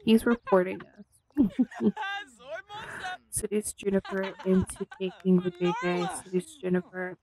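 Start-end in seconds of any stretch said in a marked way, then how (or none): 1.3-2.2 high laugh. Real, not acted. Laughing at reporting.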